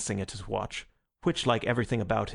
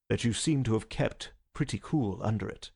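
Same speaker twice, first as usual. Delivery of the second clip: ordinary delivery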